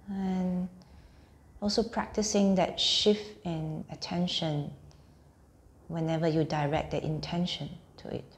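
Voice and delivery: unhurried meditation voice, phrase by phrase; guided meditation. Acted. Teacher guiding listeners lying on mats through a body scan, guided meditation.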